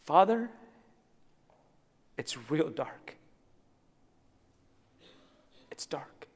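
hushed, halting plea, fading to a whisper; a grieving father on his knees, barely able to speak; broken prayer. Retold or imitated. Grieving father prays to God at night, fading to a whisper.

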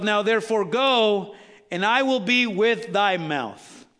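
slow, drawn-out proclamation; emphatic scripture reading. A narrator's reading. Preacher reading God's command to Moses, slow emphatic proclamation. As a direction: declare the verse slowly, stretching the words.

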